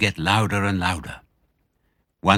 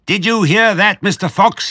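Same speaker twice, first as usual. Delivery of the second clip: high, loud taunting shout; yelling; 12 semitones higher, 7 dB louder than usual. A narrator's reading. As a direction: bellow it down the foxhole. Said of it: "yelled Bean".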